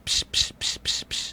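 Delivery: soft, voiceless psh sounds; rapid hushed bursts of psh; imitated hitting noises. Recalling a ghost attack from a show, imitated whooshes. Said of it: "picks that chick up by the hair and goes".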